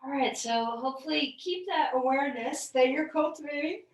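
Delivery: talking through a yawn; stretched yawning words. Real, not acted. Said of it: "I'm sorry, I'm yawning".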